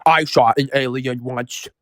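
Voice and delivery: Dave's odd voice. Retold or imitated. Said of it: "Dave had a way of talking".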